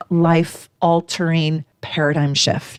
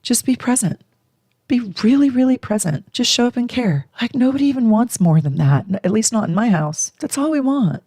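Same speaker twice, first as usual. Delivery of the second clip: earnest mom; 6 semitones higher than usual. Retold or imitated. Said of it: "And I was like".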